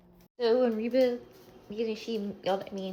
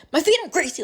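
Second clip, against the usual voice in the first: louder, higher imitation of a complaint; mock complaint; 7 semitones higher, 13 dB louder than usual. Speaker imitating the boyfriend's remark about feet, mock complaint. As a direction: throw the quote out high and loud.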